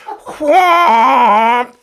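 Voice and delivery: big blown raspberry fart noise, high and loud; loud mock fart. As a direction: blast a cartoon fart sound with a laugh. Fart noise next to Rob.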